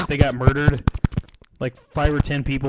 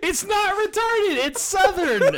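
squeaky put-on voice; slower than usual. Defending the Southern voice.

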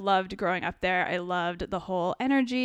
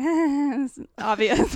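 mock fake laugh; 5 semitones higher, 5 dB louder, much slower than usual. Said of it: "pretend to laugh 'cause we were just like".

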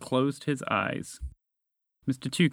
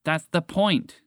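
raised-pitch, testy retort; slightly exasperated storybook voice; somewhat irritated; 6 semitones higher, slower than usual. Acted. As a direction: make the point with mild annoyance. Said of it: "somewhat irritated at Roger".

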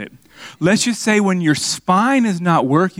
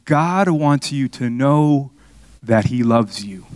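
slow earnest words; slower than usual. Retold or imitated. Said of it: "And so I said".

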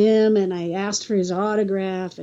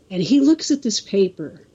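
normal talking voice

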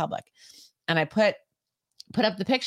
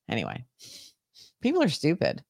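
normal talking voice

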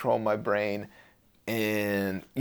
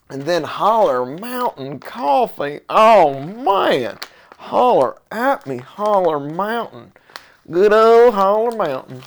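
slow, higher southern drawl on the coffee name; southern accent; 7 semitones higher, 5 dB louder, slower than usual. Acted. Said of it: "I've been talking in a southern accent".

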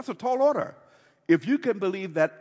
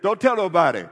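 firm warning; 2 semitones higher, 9 dB louder than usual. Retold or imitated. Jesus' warning repeated, punched.

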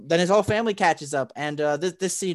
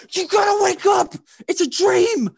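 high, loud desperate shouting; frantic yelling; 13 semitones higher, 8 dB louder than usual. Retold or imitated. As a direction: scream at him to wake up. Reflection yells at dreaming Tanjiro.

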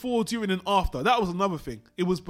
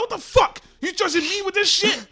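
much higher and louder furious outburst; angry uncle; 12 semitones higher, 7 dB louder than usual. Retold or imitated. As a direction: explode at the nephew in outrage. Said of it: "then uncle's like".

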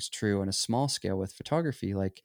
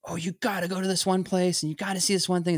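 gushing traveler; 9 semitones higher than usual. Retold or imitated. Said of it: "someone's like".

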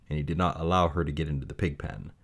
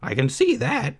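put-on high, lonely young pig voice; high-pitched bored piglet; Wilbur the pig; 12 semitones higher, 3 dB louder than usual. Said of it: "replied Wilbur".

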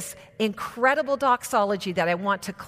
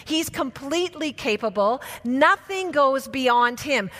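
normal talking voice

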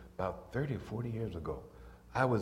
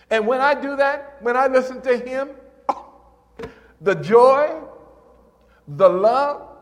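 high, loud swell of joy; rapturous preacher, voice lifted way up; exultant preaching; 11 semitones higher, 11 dB louder than usual. Real, not acted. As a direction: ring out each phrase high and full, then ease at the end.

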